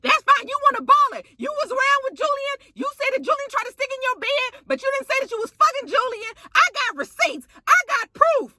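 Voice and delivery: very high, heated mock rant; high-pitched, hammed-up quarrel voice; mimicked accusing tirade. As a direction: imitate a diva firing off accusations at the top of her range. Mimics Prima Donna's accusing tirade.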